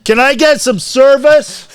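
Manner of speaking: mock-demanding shout, very high and loud; hollering for service. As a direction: yell for the bartender as a joke.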